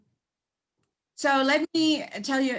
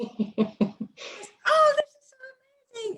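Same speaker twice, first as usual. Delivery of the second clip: much higher, fast, teary-sounding gush; mock gushing owner; slower than usual. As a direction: gush like she's about to cry. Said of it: "I was like".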